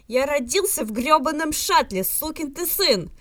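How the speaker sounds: loud, high, slowing furious yell; furious roar. A narrator's reading. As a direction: bellow it out, leaping to your feet. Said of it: "ракочет Джим". (Джим в ярости орёт на голограмму, вскакивая)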